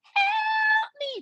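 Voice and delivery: high shrieking cry of help me; mock scream for help. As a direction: wail it like someone trapped and desperate. Neighbours screaming from locked buildings.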